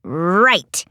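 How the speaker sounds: low, firm Lizzie voice; understated anger. A narrator's reading. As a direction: drop low with a touch of anger. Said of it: "eyes angry".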